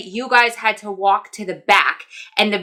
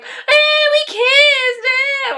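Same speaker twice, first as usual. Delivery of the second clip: put-on high, squealy childish giggle-talk; much slower than usual. Telling her friend about the kiss, in a high childish squeal. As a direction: squeal it like a giddy little kid. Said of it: "like a child".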